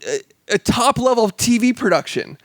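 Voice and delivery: incredulous exasperation. Retold or imitated. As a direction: protest in disbelief, stretching the final words. Acting out his exasperated reaction.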